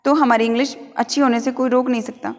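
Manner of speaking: ordinary talk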